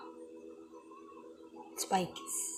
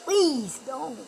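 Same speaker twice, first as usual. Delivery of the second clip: high, loud plea for a toy; pleading; 7 semitones higher, 8 dB louder than usual. Pretend. Playing with a toy, begging in a high loud voice. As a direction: beg it not to happen.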